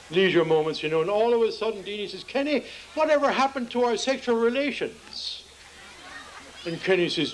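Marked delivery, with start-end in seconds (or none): 2.2-5.4 a hint of a higher wife voice. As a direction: lightly voice the wife, pitched up.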